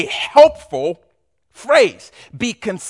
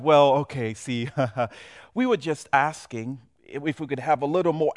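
meek customer; 5 semitones lower, 6 dB quieter than usual. Acted. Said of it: "here's the owner".

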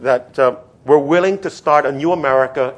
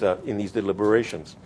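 normal talking voice

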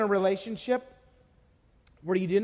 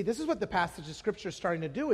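ordinary talk

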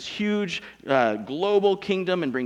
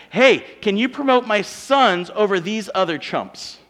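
mommy pleading; 5 semitones higher, 6 dB louder than usual. Retold or imitated. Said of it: "asked their mommy".

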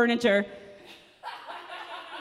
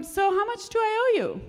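raised, timid customer asking; high-pitched polite customer; 10 semitones higher than usual. Acted. Customer voiced in tipping bit.

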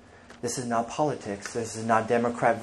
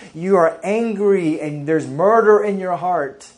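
preaching as Jesus; 3 semitones higher, 6 dB louder than usual. Retold or imitated. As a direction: call them out, rising to full force. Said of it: "And Jesus says".

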